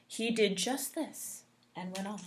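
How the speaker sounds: hushed whisper. A narrator's reading. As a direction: drop to a secret whisper. Whispered aside.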